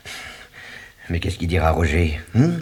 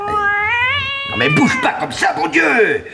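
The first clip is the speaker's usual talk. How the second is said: crier, plus aigu et plus fort; s'exclamer sous les griffes; cri au chat. Real, not acted. (le maître crie à son chat qui le griffe, agacé)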